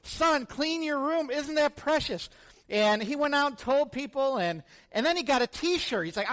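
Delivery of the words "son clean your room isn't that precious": higher, starry-eyed enthusiasm; the boy beaming over his poster idea; gushing kid. Retold or imitated. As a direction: son gushes about his dad's words. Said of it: "the son's like".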